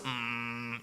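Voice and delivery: low, soft, drawn-out hum; imitated prayer hum. Believer praying alone while others pray fervently, imitated low hum. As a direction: hum low and level to yourself.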